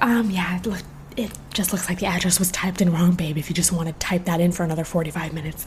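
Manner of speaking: soft, unvoiced mock-polite jab; sarcastic whisper. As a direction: whisper a fake-sweet dig at him. Sarcastic whisper to slow clerk.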